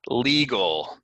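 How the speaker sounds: "Legal" said LEE-gul; long E. Shown as a demonstration. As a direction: stretch the ee in "Legal". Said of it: "but it's an E".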